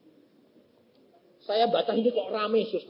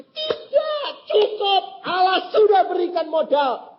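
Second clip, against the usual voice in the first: much higher, louder shout of refusal; emphatic shout; 14 semitones higher, 7 dB louder, slower than usual. Retold or imitated. Preacher telling the young man no more asking, shouting. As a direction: cut him off with a shout.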